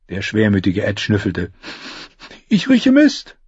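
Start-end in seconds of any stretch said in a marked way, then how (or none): 2.5-3.4 high, whiny gloomy voice. Acted. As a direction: moan it like a glum squire. Gloomy Ed speaks.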